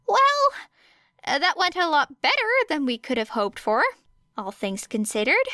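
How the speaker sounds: high, sheepish relieved voice; awkward relief. A narrator's reading. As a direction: chirp it out nervously, pitch up. Hizashi relieved, high pitch.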